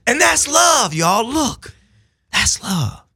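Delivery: high, loud joyful hollering; exuberant. Real, not acted. Storyteller cheering to his listeners about love, high and joyous. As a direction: celebrate it like a preacher. Exuberant laughing punchline to audience.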